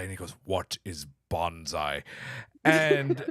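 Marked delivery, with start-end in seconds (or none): 0.4-2.0 low, soft and drawn-out question; curious alien quote. Retold or imitated. Retelling an alien's question about bonsai, low, slow imitation.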